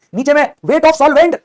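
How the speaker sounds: "weight of solvent" high, loud and stretched; emphatic formula teaching. Shown as a demonstration. Teacher stressing the denominator to students, "weight of solvent" loud and drawn out. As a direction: belt out "weight of solvent" slowly.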